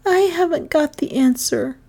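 plain reading voice

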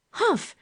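high, sharp scoffing humph. A narrator's reading. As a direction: scoff at him with a short humph. Girl scoffs at his guess.

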